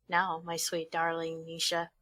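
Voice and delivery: ordinary talk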